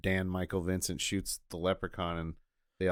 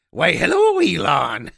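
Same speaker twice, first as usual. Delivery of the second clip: high, loud sly greeting; mock leprechaun greeting; 6 semitones higher, 10 dB louder, slower than usual. Cohost imagining the leprechaun meeting Elon, high sly greeting. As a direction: purr it like a sneaky imp.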